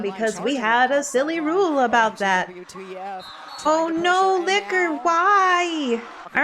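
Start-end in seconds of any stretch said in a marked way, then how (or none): 3.6-6.0 high, pleading dismay; dismayed plea. Real, not acted. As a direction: beg the skater why. Dismay at another penalty.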